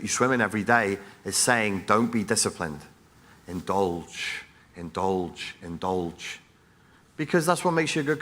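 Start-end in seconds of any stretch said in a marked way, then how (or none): 1.9-6.4 soft, seductive tempter whispering indulge; soft temptation voice urging indulgence; tempting voice. Retold or imitated. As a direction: coax like a devil on the shoulder. Culture's tempting voice, quoted.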